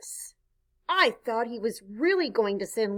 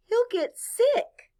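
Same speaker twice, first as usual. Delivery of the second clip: high, slow worried mother voice; 11 semitones higher, slower than usual. Acted. Mother fretting the puppy will get sick, high and worried. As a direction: fret like an anxious mom. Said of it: "My mother was very worried".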